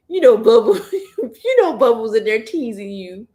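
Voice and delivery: laughing through the words; laughing reassurance. Real, not acted. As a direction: chuckle while reassuring her. Laughs with child.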